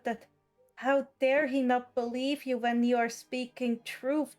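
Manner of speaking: ordinary talk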